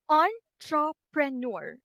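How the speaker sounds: entrepreneur drawn out slowly syllable by syllable, stress on neur; slow syllable demo. Shown as a demonstration. Teacher slowly breaking down entrepreneur into syllables. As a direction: stretch entrepreneur out slowly, each syllable clear. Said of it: "four syllables in the word".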